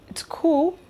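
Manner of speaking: high hushed aside; whispered reply. Pretend. Viewer secretly answering the video's question in a whisper.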